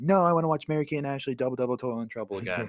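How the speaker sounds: high, rapid-fire sibling retort; bickering sister. Acted. Sister fighting brother over television, high rapid mimicry. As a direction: mimic a kid sister insisting on her show, quick and high. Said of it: "And she'd be like".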